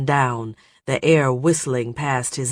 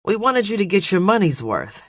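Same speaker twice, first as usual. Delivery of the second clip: casual; 7 semitones higher, 3 dB louder, faster than usual. Acted. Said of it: "tried to make it sound matter of course".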